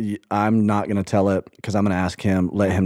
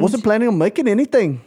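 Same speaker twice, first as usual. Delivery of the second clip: mocking a friend's Irish accent, pitched high; a breezy, lilting Irish accent; playful Irish accent; 11 semitones higher, 3 dB louder than usual. To a co-host trying an Irish accent, an Irish accent mocked high. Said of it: "That was pretty good".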